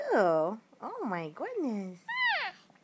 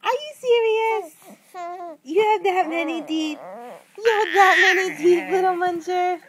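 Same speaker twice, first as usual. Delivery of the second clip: higher and much louder, gushing with amazement; 5 semitones higher, 12 dB louder than usual. Real, not acted. Parent amazed at baby's teeth.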